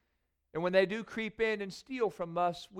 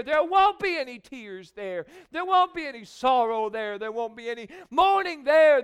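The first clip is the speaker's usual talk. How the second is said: louder, higher, rousing preacher's cry; preaching at full force; 5 semitones higher, 8 dB louder than usual. Acted. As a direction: lift the church up with it. Climax of the sermon on heaven.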